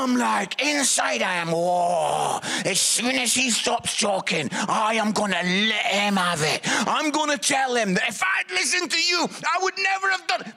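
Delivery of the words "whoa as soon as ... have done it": indignant inner rant. Acted. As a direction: let pent-up mock outrage burst out. Inner rant at the minister acted out.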